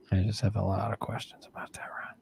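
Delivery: whispered misgiving; hushed suspicion. Real, not acted. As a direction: say it under your breath, sceptically. Mock suspicion of the toaster story.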